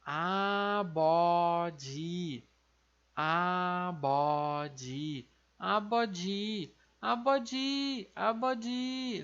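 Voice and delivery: "abode" com B sonoro e final "dji"; coreano abeoji. Shown as a demonstration. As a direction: repita "abode" devagar, B e DJ suaves. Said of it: "Vai ficar". (pronúncia coreana de "abode", devagar)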